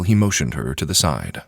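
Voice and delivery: plain reading voice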